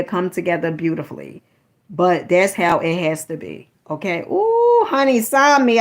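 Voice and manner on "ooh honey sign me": high squealing ooh; delighted ooh. Real, not acted. Pleased with her own rhyme, a squealing ooh.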